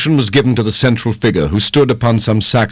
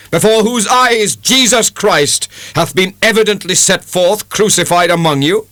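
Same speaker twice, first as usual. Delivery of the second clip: fiery preaching; 12 semitones higher than usual. A narrator's reading. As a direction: thunder it at the congregation. Preacher heard from the barn.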